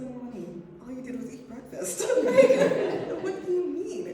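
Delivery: high, loud laughter of disbelief; laughing disbelief. Real, not acted. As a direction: laugh at the excuse. Exasperated at child's tiredness.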